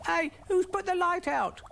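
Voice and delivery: very high squeaky snail voice; high, startled little squeal of words; squeaking. A narrator's reading. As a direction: squeak it in alarm. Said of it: "squeaked Brian".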